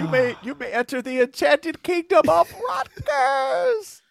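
high, slow, enchanted announcer; grand fairy-tale herald. Pretend. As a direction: proclaim the kingdom grandly.

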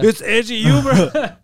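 slow, laughing "edgy humor" repeat; laughing imitation. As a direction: drag it out through a laugh. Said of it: "But he was like".

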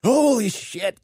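reenacted startled yelp, pitch leaping high; high-pitched mock fright; startled exclamation. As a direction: blurt it high, as if you just jumped. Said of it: "I jumped up in the air and said".

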